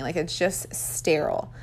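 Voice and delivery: whispered, under her breath; breathy whispered aside. Real, not acted. Aside about the sterile room.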